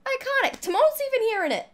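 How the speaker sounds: high-pitched, quick excited squeal. Real, not acted. Streamer reacting to the game trailer, excited squeal.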